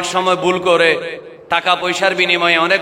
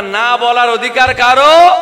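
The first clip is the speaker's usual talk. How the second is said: louder, higher call to the crowd; rousing call; 5 semitones higher, 6 dB louder than usual. Real, not acted. Preacher has congregation answer once more, loud rousing call. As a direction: lift it into a ringing question.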